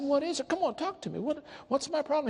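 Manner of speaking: high-pitched impatient begging; eager pleading. Retold or imitated. Golfer begging his partner to name the problem, eager pleading. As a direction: press for the answer in a high, urgent voice.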